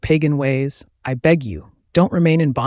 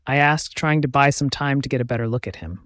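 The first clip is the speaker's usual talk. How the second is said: plain reading voice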